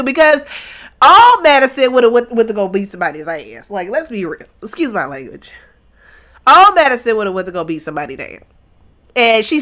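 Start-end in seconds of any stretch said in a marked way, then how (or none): none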